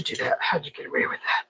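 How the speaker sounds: conspiratorial whisper to the chips. Pretend. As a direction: lean in and whisper the question. Teasing two stuck chips.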